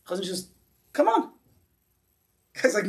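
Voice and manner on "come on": coaxing call. Retold or imitated. Chazon Ish calling him into water.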